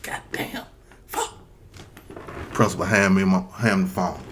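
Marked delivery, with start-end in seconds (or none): 0.0-1.3 unvoiced whispered cursing; muttered dread. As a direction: curse under your breath.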